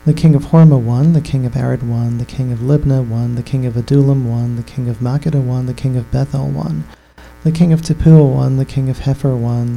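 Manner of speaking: normal talking voice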